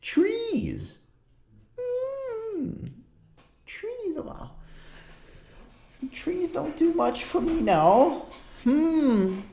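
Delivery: put-on high, musing old shop woman; shopkeeper woman. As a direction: ponder the order in a high, fussy voice. GM voicing the shopkeeper.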